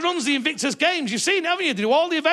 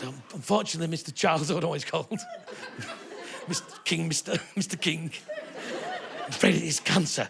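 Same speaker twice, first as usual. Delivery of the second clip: much lower, much softer nervous doctor; timid doctor; 9 semitones lower, 8 dB quieter than usual. Retold or imitated. A doctor nervously telling the king he has cancer, low and hushed. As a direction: mumble the bad news like a nervous doctor. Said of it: "I said".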